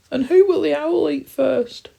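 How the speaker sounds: very high, loud squeal; terrified guinea pig. Acted. Guinea pig dreading the owl, squealing in terror. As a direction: cry the question in panic.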